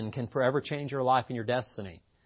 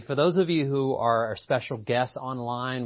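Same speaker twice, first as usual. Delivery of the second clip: usual speaking voice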